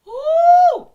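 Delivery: loud high cheer; excited whoop. Real, not acted. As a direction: whoop with joy. Cheering at gift.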